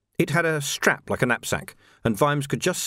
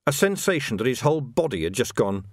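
usual speaking voice

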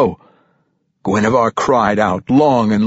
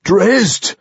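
high, urgent shout; raised, alarmed cry; shout from behind; 9 semitones higher, 4 dB louder than usual. A narrator's reading. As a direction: call the name out from afar. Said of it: "came a shout".

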